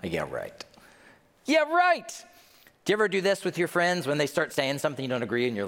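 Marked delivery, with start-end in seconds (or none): 0.0-0.6 low, quiet dismissive scoff. Acted. Crowd doubting the prophecy, quietly scoffing. 1.5-2.2 high, punchy mock disbelief; exaggerated disbelief. Repeats the scoff, far louder.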